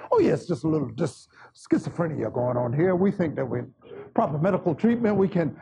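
very low, quick murmur of a deacon; Deacon Harden. Acted. Church deacon explaining away a possession, low mock-deacon voice. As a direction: drop low and make it a dismissive diagnosis. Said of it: "That's what Deacon Harden would say".

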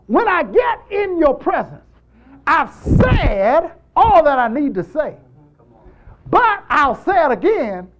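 loud, high-pitched declaration; emphatic preaching. Real, not acted. Preacher telling congregation he'll say it again, loud and emphatic.

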